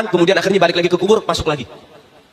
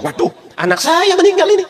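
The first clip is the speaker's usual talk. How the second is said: higher, shocked cry of dismay; dismayed saint; 11 semitones higher than usual. Acted. Saint finds his little daughter dead, high dismay.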